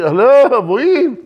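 high, incredulous hello, where to; bewildered protest. Acted. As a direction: pitch up in disbelief.

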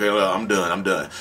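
lightly acted resignation, dropping low; a touch of fed-up finality; partner giving up. Partner finally deciding to leave, mildly resigned. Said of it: "when you finally decide that you wanna leave".

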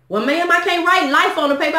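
a hint of a prim call-center lady; mocking phone agent voice. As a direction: lightly mimic the collector's polite script. Agent's reply in retold call.

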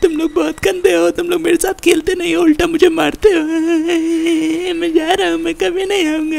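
hammed-up, high tearful whine; crying. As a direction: wail out the complaint, trailing long sobs after phrases. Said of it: "इतना रो क्यों रहा है".

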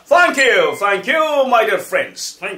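high, drawn-out shouted thanks; big, slow, exuberant farewell; loud sign-off. Real, not acted. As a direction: belt out thanks to the audience. Big sign-off after loud chant.

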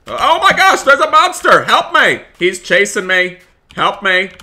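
very high, loud shriek easing into pleading; mock terrified scream. Being chased by a monster in the game, hammed-up screaming. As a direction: shriek at the monster, then keep begging.

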